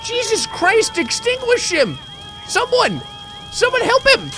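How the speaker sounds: high, loud mock panic; squealing fake alarm at a burning man. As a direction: shriek for help like a hammy bystander. Panicked calls for help over fire.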